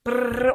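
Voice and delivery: rolled trilling sound effect; imitated panel noise. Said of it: "barulho daquele painel fazendo". (imitando barulho de painel)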